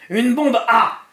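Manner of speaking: high, loud crow of triumph; triumphant. A narrator's reading. Trashcan answers Lloyd about his cargo, triumphant. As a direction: crow it like a proud madman. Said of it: "d'une voix triomphante".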